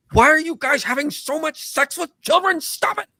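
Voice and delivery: high, loud, squawking outrage; exasperated missionary scolding. Acted. Appalled missionaries confronting sinners everywhere, high outraged shout.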